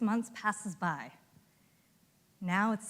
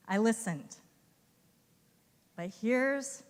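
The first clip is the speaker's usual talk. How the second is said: ordinary talk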